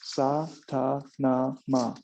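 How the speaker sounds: Sa-ta-na-ma, each syllable slow and even; syllable demo. Shown as a demonstration. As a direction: spell out Sa-ta-na-ma slowly. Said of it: "S A T A N A M A".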